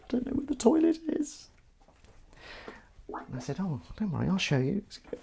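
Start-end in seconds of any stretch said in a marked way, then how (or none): 0.0-1.5 very high, small mock-tearful voice; high-pitched imitation whimper; crying newcomer. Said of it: "I found him crying in the corner". 3.6-4.8 soft, higher soothing voice; hushed mock-kindly reassurance; reassuring. Comforting the crying newcomer, acted.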